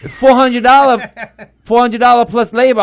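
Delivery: Chinese accent impression. Chinese mechanic scolding him about the Kia, Chinese accent. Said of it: "he goes".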